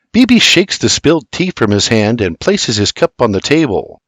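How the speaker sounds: ordinary delivery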